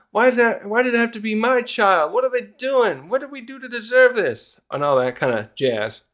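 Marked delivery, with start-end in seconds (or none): 0.1-4.6 high-pitched mock parental wailing; lamenting parent. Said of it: "some other parents there of one of them going".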